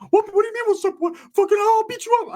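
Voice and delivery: squeaky, wired mock threat; very high voice, jumpy and combative; Ricky. Wired cousin threatening narrator, squeaky high imitation. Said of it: "Ricky's fucking just on a good one".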